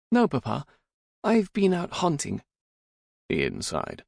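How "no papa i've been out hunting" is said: high-voiced guileless daughter; pitched well up, playing innocent. A narrator's reading. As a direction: answer Papa in a high, innocent voice. Daughter innocently answering her stern father.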